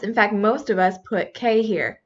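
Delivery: usual speaking voice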